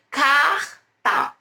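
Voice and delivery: "carta" with a drawn-out throaty R, pause, then ta; sons separados. Shown as a demonstration. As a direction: split "carta" so R and T sound apart. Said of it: "Percebam o som". ("carta" com R de garganta alongado, pausa antes do ta)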